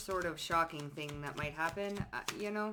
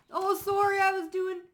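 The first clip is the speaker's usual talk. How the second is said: high, louder mock sorry voice; fake apology; 12 semitones higher, 6 dB louder than usual. Mocking a fake apology to the ex, high mock voice. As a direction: whine out an insincere sorry. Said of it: "fake apology".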